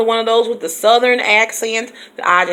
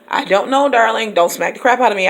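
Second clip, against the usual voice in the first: usual speaking voice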